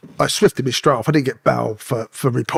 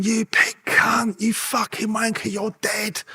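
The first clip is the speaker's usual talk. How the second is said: slow, high, strained mock yell held down in volume; jeering inmates; 9 semitones higher, much slower than usual. Inmates abusing an ex-policeman walking through the wing, menacing. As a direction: imitate distant threats, drawn out and high.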